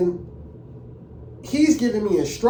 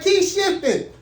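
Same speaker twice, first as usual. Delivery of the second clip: much higher, louder insistent command; imitated examiner order; 12 semitones higher, 3 dB louder, slower than usual. Examiner repeating his order over the tracks, high and pushy.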